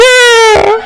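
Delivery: high, hammed-up tearful wail; mock crying. [name] owning up that he hit him, mock crying. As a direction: bawl it like a crying kid. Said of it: "and I'm crying".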